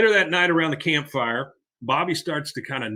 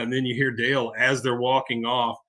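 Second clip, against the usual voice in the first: ordinary delivery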